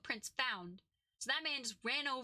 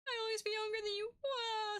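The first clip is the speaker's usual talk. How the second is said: high, whiny fake cry; mock sobbing; 9 semitones higher than usual. Witness crying after the judge's scolding, high mock sobbing. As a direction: wail it like a hammed-up crybaby. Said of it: "sobs. Starts crying".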